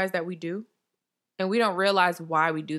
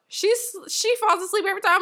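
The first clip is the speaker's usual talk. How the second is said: pitched way up, teasing; high teasing; 11 semitones higher than usual. Real, not acted. Teasing her friend who always falls asleep.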